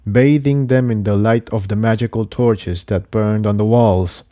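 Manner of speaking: usual speaking voice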